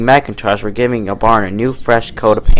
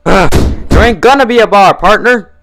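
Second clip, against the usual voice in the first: high, loud, exasperated ugh; angry retort; 11 semitones higher, 6 dB louder than usual. A narrator's reading. Applejack snaps at Red Tape, angry and loud. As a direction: bite back in anger. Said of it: "Applejack replied angrily".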